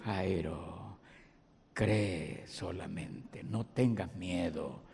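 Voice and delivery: suave, bajo y lento, tranquilizador; consuelo suave. Acted. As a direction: calmar a un padre asustado. Jesús consuela a Jairo. (Jesús calma a Jairo, suave y lento)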